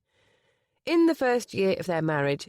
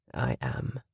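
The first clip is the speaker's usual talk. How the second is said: soft breathy answer; 9 semitones lower, 4 dB quieter than usual. Acted. Tearful groom answers softly.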